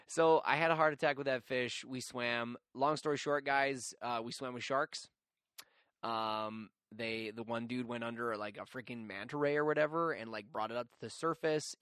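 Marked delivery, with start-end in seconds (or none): none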